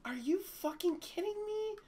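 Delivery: high-pitched outraged disbelief; incredulous. Real, not acted. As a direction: squeak it out in disbelief.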